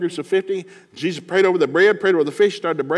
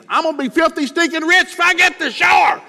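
much higher, loud gleeful shout; greedy excited shout; 13 semitones higher, 5 dB louder than usual. Acted. Peter gloating about his catch, high loud excited shout. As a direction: crow about the riches, slowing down.

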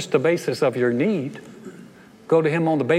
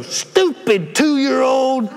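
very slow, high, louder scoffing drawl; mock dismissive parent; 10 semitones higher, 6 dB louder, much slower than usual. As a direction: drag it out with exaggerated disdain. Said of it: "And you don't go".

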